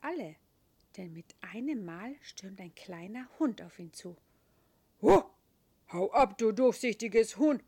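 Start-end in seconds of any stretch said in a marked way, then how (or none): 5.0-7.6 loud, changed dog voice yapping; yapping terrier. Acted. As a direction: yap it out like a cheeky little dog. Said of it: "kläfft der Terrier".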